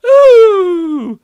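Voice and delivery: loud high ghost ooh; ghostly ooh. Pretend. Ghosts in the boardroom, loud moaning ooh. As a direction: moan like a ghost.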